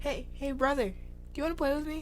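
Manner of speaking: high whiny little sister voice; Sydney imitation. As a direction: pitch way up and whine like a kid. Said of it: "your imitation".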